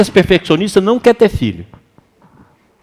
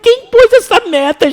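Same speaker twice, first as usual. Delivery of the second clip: high, slow astonished question; incredulous retort; 3 dB louder than usual. Retold or imitated. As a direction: ask in disbelief who set that goal. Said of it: "Eu falei".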